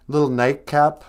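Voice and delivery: ordinary delivery, nothing marked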